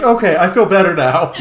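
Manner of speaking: loud, laughing "feel better now"; laughing mock relief. Joking about a spray tan to friends, laughing. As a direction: laugh through the self-reassurance. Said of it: "It's like".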